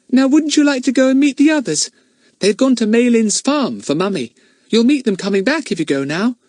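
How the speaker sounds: put-on cheerful little girl sending him off; Betts coaxing. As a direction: Betts urges Ern to go meet the others.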